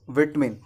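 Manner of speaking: विटमिन as "VIT-min"; no long "aa" in विटमिन; correct pronunciation. Shown as a demonstration. Teacher repeats for learners, विटमिन as "vit-min". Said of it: "क्या होता है".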